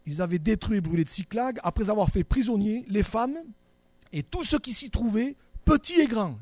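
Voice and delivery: loud, deliberate Bible reading to the congregation; scripture reading. Acted. Reads scripture aloud. (lecture solennelle et forte)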